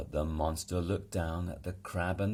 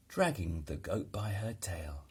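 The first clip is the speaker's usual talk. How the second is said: plain reading voice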